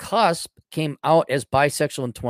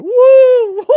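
shrill high whoop into a loud laugh; gleeful whoop and cackle; 13 dB louder than usual. Real, not acted. Gloating at the coming misery with a high whooping laugh. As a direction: cheer then break into a cackle. Gleeful outburst about antidepressants.

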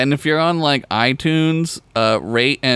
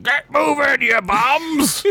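high, barking cartoon sergeant; drill sergeant; 13 semitones higher, slower than usual. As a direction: bark orders like a sergeant. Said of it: "a drill sergeant sperm".

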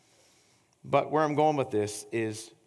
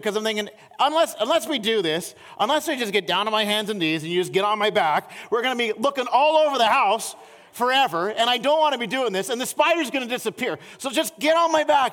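rising higher and louder with exasperation; building frustration; 9 semitones higher, 7 dB louder than usual. Retold or imitated. Husband retelling his frantic reasoning about the spider, building exasperation. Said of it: "I'm, like, getting frustrated".

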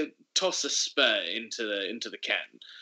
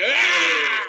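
high, loud shout; shout after a shot; 8 dB louder than usual. Acted. Character downing his shot, loud high shout. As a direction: bark it out after downing a drink. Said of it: "I'll take the shot and go".

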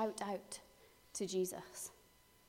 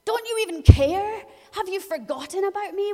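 much higher, louder accusing cry; anguished cry; 9 semitones higher, 12 dB louder than usual. Acted. A believer crying out to God, anguished. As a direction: hurl it at God in distress. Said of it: "Our first response is often".

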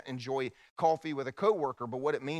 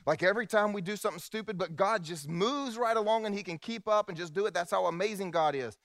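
retold youth sermon; 5 semitones higher than usual. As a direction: replay your youthful hype sermon. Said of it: "I preached".

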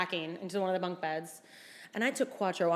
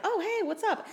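mock cheerful greeting; 11 semitones higher than usual. Greeting strange man in denim.

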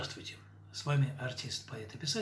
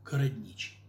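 plain reading voice

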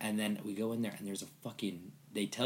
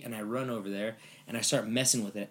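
ordinary talk

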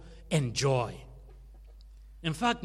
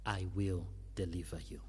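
quick, hushed whisper of "I will deliver you"; whispered promise; 9 semitones lower, 6 dB quieter, faster than usual. Acted. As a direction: breathe the promise out fast and secret. Repeats God's promise hushed.

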